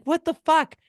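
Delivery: high, loud retold outrage; exasperated disbelief. Reacting to the heckler in the theater, high loud disbelief.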